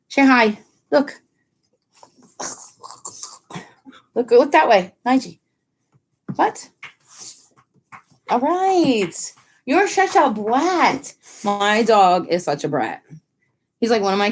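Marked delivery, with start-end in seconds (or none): 0.1-6.7 higher, bright cooing at the dog; pet talk to dog. Real, not acted. Owner urging her dog to greet viewers, high cooing. 8.3-11.1 high, slow teasing coo. Real, not acted. Owner fondly teasing her dog, high slow baby talk.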